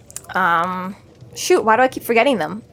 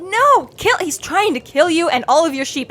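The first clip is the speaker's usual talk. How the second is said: exasperated; 6 semitones higher than usual. Retold or imitated. Exasperated mock protest.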